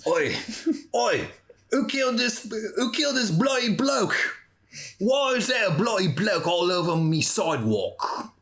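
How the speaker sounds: high, cockney-style put-on British voice; mock British accent. Joking about a British detective show, in a hammed-up cockney accent. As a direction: ham up a London copper's bloody bloke.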